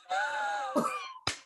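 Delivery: a high, squeaky burst of laughter; high squealing laugh. Real, not acted. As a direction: let a squeal of laughter escape. Laughs at the church question.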